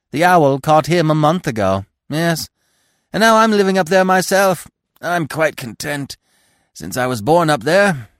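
high, loud, matter-of-fact squirrel; chatty squirrel. A narrator's reading. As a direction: deliver family news in a high animated voice. Squirrel telling his news.

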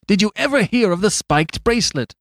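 loud, high outburst; astonished cry. Acted. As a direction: cry out in disbelief. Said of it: "cried Flambeau".

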